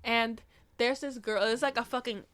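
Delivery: ordinary talk, nothing marked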